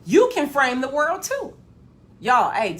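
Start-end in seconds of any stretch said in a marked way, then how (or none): none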